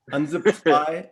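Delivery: ordinary delivery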